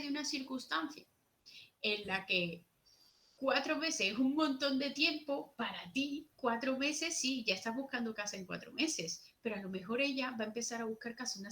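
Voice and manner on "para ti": normal talking voice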